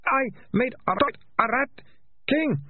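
high, halting wail; tearful confession. A narrator's reading. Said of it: "Tears ran down his face".

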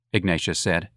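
usual speaking voice

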